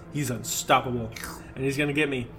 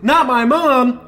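loud high shout, the second slower; desperate shout; 9 semitones higher, 10 dB louder, slower than usual. Acted. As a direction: scream it to stop him. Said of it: "I shout".

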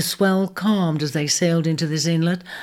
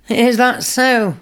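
sceptical; 6 semitones higher, 5 dB louder, slower than usual. A narrator's reading. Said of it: "responded the questioner, and still sceptical".

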